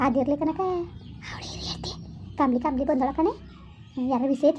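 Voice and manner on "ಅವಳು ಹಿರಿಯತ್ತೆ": breathy gossip whisper. Acted. Woman gossiping to neighbour Kanaka, whispering. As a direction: lean in and whisper the name.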